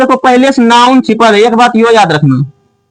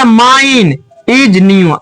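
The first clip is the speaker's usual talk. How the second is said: slow dictation; much slower than usual. Shown as a demonstration. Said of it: "फिर है".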